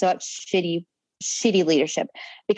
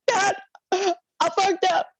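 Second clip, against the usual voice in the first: high, slow, wailing confession; hammed-up tearful whine; mock crying; 10 semitones higher, much slower than usual. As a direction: imitate blubbering to a parent. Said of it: "You know, I'm crying".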